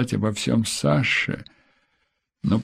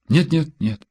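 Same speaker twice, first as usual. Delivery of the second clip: hurried, faltering; 6 semitones higher than usual. A narrator's reading. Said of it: "немного сбиваясь, торопливо сказала она".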